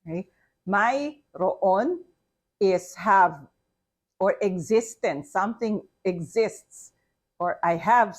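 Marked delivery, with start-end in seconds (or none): 0.7-2.0 "Mayroon" as written, may-ro-on; spelled-out pronunciation. Shown as a demonstration. Teacher repeating the word for learners, pronounced as spelled. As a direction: sound out each syllable of "Mayroon". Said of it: "say it first as we spell it".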